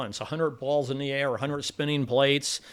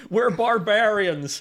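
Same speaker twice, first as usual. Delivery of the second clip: put-on slow, drawn-out Connecticut accent, higher; 6 semitones higher, 4 dB louder, much slower than usual. Host mimics the actress's Connecticut accent on her line. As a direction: imitate Hepburn's Connecticut accent, lightly. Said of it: "with that Connecticut accent".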